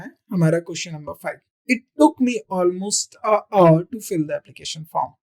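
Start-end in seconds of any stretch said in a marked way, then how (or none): 1.7-5.1 word by word, slowly and carefully; a teacher reading question five to students, slowly; slow dictation read. Shown as a demonstration. Teacher reads exam sentence aloud.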